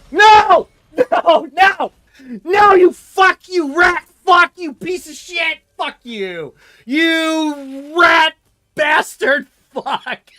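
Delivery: high, loud, drawn-out mock fury; mock rage yell. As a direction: scream insults at the enemy for fun. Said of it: "It seems like I was mad ... not in, like, a real way".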